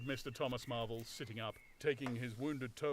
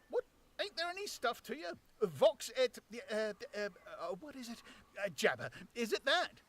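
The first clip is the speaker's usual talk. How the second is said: high, halting tramp voice groping for a word; high, stumbling, baffled tramp; puzzled tramp; 10 semitones higher, 4 dB louder than usual. Acted. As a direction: fumble for the fancy word. Tramp voice held.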